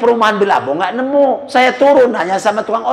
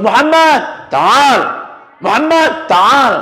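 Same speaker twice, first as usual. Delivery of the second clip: calling out; 6 semitones higher, much slower than usual. Retold or imitated. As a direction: summon him from afar.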